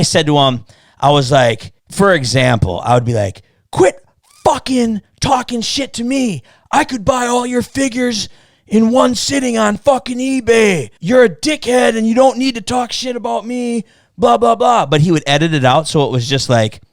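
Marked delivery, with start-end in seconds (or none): 3.7-14.9 high-pitched imitated rant; mock angry voicemail. Re-enacting his voicemail to a man insulting him, mock angry. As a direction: re-enact a hotheaded tirade, voice way up. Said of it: "I sent all these voicemails".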